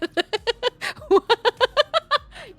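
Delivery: high, loud laughter; laughing fit. Real, not acted. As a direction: burst into a long high-pitched laugh. Laughing at oversized alert.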